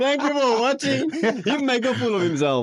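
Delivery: mock him gleefully. Real, not acted. Mocking friend's attempt.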